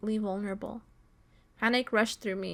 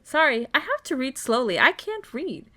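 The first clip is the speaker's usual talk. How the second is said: louder, high swooping self-mocking complaint; exasperated apology; 4 semitones higher, 6 dB louder than usual. Real, not acted. Reader apologizing to viewers for stumbling, exasperated apology. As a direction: wail it at your own clumsy reading.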